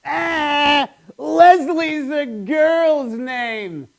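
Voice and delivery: put-on high, slow taunting kid voice; high sing-song taunt; mocking kid. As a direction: taunt like a schoolyard bully. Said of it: "People have been making fun of me".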